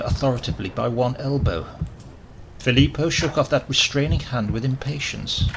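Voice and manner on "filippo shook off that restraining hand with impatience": ordinary delivery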